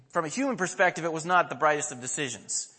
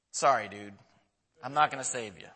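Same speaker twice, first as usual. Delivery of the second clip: mock indifference; 5 semitones lower, 3 dB quieter than usual. Mock answer Jesus would not give.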